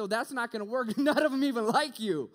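loud, high, laughing disbelief; incredulous laughing protest. Retold or imitated. Student laughing at God's idea, loud disbelief. Arguing back to God, laughing.

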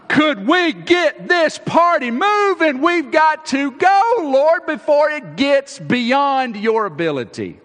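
high, loud and slow, pressing hard; loud urgent pleading with the Lord; urgent plea. Acted. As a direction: beg him to hurry up. Jairus urging Jesus onward.

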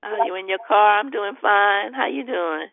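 ordinary delivery

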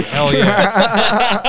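loud laughing hell yeah; loud reply. Retold or imitated. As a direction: bellow it back, half laughing. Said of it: "And you'd say".

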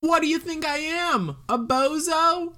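high, loud mock outrage; mock offended. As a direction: act insulted.

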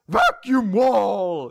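high, loud, drawn-out spell call; devil casting a spell. Retold or imitated. Host voicing the devil absorbing the beam, theatrical bellow. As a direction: bellow the attack name slowly. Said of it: "the devil's like".

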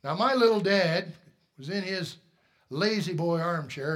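normal talking voice

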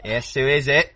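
lightly put-on Australian accent, answering the door; a hint of an Australian farmer at his door; hammed-up Australian accent, farmer greeting a visitor; Australian sheep farmer. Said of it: "you're gonna be an Australian sheep farmer".

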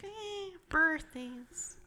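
high, soft mimicked shrug; mock indifference. Wife imitating husband's lack of birthday excitement, mock indifferent. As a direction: imitate someone barely bothered, in a small high voice. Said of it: "you're, you're like".

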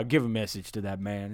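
low, hushed gruff man's voice; a lightly voiced wary tough guy; suspicious hero. Voices McConaughey on phone.

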